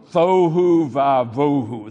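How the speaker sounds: slow, loud, booming bass; extremely deep bass voice. Shown as a demonstration. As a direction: boom it out as deep as you can. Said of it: "say it in the deepest voice you can".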